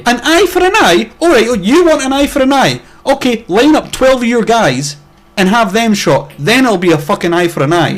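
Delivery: high and loud, sarcastic and outraged, slowing down; indignant rant. Retold or imitated. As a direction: hammer their logic back at them. Mocking the soldiers' logic.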